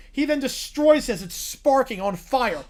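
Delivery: usual speaking voice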